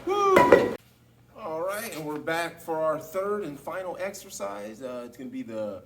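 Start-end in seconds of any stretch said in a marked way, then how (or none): 0.0-0.7 excited whoop. Real, not acted. Set finished.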